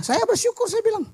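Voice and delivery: high, mocking boastful pastor voice; mock bragging about branches. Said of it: "orang bilang".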